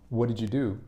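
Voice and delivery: "what did you do" run together as one chunk; common-speech pronunciation. Shown as a demonstration. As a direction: link "what did you do" smoothly, reduced as in everyday talk. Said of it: "in common speech".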